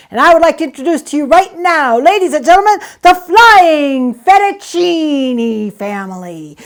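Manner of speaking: loud, stretched showman intro; ringmaster announcement. Acted. Performer introducing the act to ladies and gentlemen. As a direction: sell the act to the crowd.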